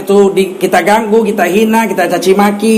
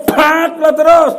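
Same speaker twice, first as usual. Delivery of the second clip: mock angry shout; 6 semitones higher, slower than usual. Husband confronts the thug harassing wife.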